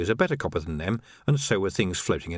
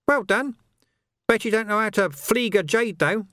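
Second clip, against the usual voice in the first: high, sly, wheedling urchin voice; high-pitched cockney urchin hustling a copper; cheeky street urchin; 11 semitones higher, 3 dB louder than usual. Acted. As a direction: haggle like a grubby street kid. Urchin Nobby's voice.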